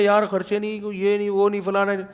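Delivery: softly muttered money worries; mock fretting. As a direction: imitate a parent fretting under their breath. Mimicking a worried, complaining parent.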